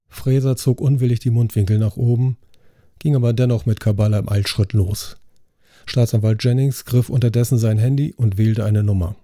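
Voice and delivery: ordinary delivery, nothing marked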